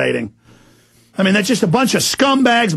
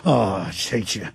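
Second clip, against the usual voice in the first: low quiet contempt; 12 semitones lower, 8 dB quieter, slower than usual. Real, not acted. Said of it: "I get a little fired up".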